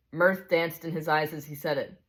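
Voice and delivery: ordinary delivery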